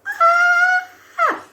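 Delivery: high, squealed scream; excited scream. Retold or imitated. Reacting to booking a first role, delighted squeal. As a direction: let out a re-enacted squeal of joy.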